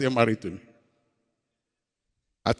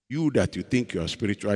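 usual speaking voice

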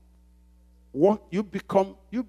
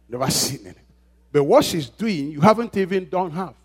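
ordinary talk

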